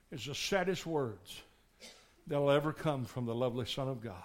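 ordinary talk, nothing marked

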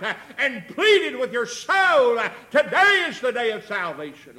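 loud, high-pitched pulpit exhortation; full-force preaching. Real, not acted. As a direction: drive each phrase home to the congregation.

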